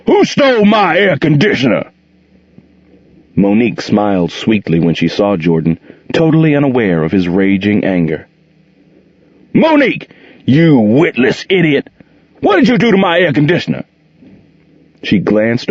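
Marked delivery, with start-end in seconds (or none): 0.1-1.9 high, booming yell of rage; bellow. A narrator's reading. Jordan bellowing from his bedroom, enraged. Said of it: "she heard him bellow". 9.5-13.8 much higher, furious shouting; angry yell. A narrator's reading. Jordan yelling at Monique about the air conditioner, furious.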